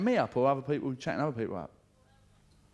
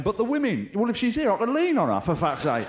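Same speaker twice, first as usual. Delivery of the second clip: high, loud tipsy scheming; the sly part of a drunk mind plotting; drunk inner voice; 8 semitones higher, 6 dB louder than usual. Acted. Drunk bloke at a club eyeing a woman to lean on, high and loud. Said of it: "a little bit of you going".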